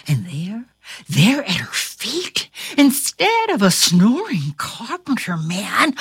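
plain reading voice

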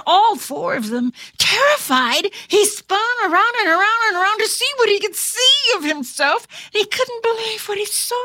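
usual speaking voice

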